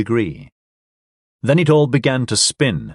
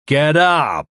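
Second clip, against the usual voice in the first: louder, higher angry yell; 5 semitones higher, 3 dB louder than usual. Acted. Man yelling at the drunk on the floor, angry yell. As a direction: bark him awake. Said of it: "he yelled".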